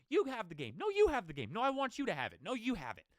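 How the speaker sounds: high, loud mock politeness, passing the game back and forth; mock polite standoff. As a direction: play two teams too polite to take the win. Acts out teams giving game away.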